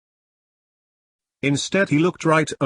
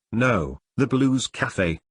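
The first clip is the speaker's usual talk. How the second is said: slowly, spelling out Blues Cafe; slow clear correction; slower than usual. Acted. [name] corrects Tyler's misheard cafe name, slow and clear. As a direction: set him straight, word by word.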